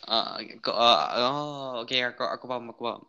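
ordinary delivery

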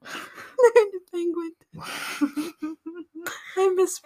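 giggling through the words; laughing. Shown as a demonstration. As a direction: laugh it out, slow. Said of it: "Say it again for the listeners".

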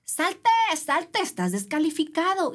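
mock a boat crew member ordering her out; imitated yelling official. Race crew ordering the swimmer out of the water, mock yell. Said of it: "a gritarte".

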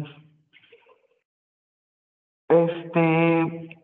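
ordinary talk, nothing marked

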